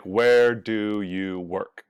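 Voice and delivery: "Where do you work?" said slowly, each word clear; slow demo question. Shown as a demonstration. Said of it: "Something like".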